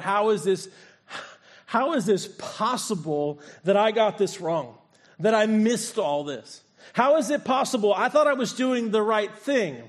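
a broken man asking how he got it wrong; anguished disbelief. Retold or imitated. Saul in anguish over his mistake, pleading. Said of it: "he must have thought".

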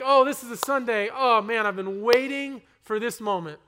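bursting with expectation for Sunday's sermon; eager excitement. Retold or imitated. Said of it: "I'm like".

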